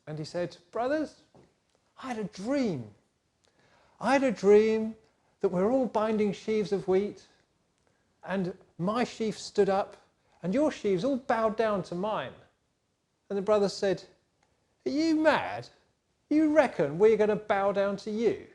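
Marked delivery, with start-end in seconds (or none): 0.7-12.3 high, eager voice, proud of his dream; excited boasting. Acted. Joseph bragging to his brothers about his dream, high and eager. 14.8-18.5 scoffing brothers. Acted.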